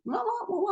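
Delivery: hammed-up babyish wailing; mock whining. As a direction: whimper like a confused baby. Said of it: "You're like".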